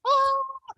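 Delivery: a sudden high yelp as he drops; high falling yelp. Retold or imitated. Imitating the man falling off the pole, high yelp.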